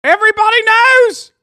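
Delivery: hammed-up yell; mock shout. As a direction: bellow it at the room. Shouting punchline of the rant.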